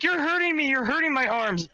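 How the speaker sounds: high-pitched imitation of a complaining woman; put-on high voice, no real volume; mock screaming complaint. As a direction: whine it high, mimicking her protest. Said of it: "she's screaming that I'm hurting her".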